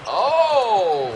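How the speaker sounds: high, loud drawn-out oh; real shock. Real, not acted. Co-host didn't know that happened, loud high shock. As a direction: react loudly to a sudden shock.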